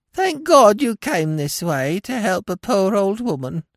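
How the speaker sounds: high sing-song crone whine; whining old woman. A narrator's reading. Old woman pleading for Smith's help, high sing-song whine. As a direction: plead pitifully like a helpless old beggar. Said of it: "she whined in a sing-song voice".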